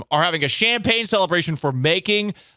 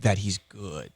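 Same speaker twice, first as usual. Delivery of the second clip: low, soft, deadpan praise; quietly dropped, dubious-sounding reassurance; sarcastic-sounding; 9 semitones lower, 8 dB quieter, slower than usual. Real, not acted. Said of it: "I know that sounds sarcastic".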